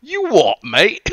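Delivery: hoog en luid, overdreven Brits: You what, mate?; mock British accent. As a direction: een Britse straatjongen nadoen, lachend. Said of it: "That is not an Aussie accent".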